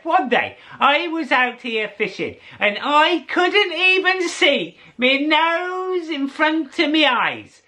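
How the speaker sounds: put-on high, lilting old fella telling a tall tale; old fisherman voice. Old fisherman boasting to the narrator about mist. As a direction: spin the yarn like an old Irish fisherman. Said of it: "He said".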